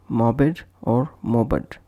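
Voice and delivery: "mobbed" in two syllables, mob-id, then mob-ud; wrong extra syllable. Shown as a demonstration. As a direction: add a vowel before the d of "mobbed". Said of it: "and not".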